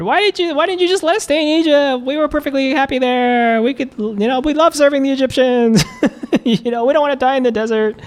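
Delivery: high mock whine with a laugh; whining Israelites. Preacher mocking the Israelites' complaint, high whiny voice. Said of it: "Israelites complain".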